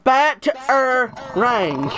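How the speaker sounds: Bat, er, rang in three separate beats; syllable-by-syllable model. Shown as a demonstration. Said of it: "Say it like I say it".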